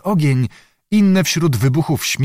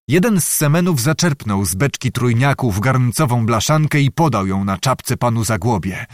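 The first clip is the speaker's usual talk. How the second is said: ordinary delivery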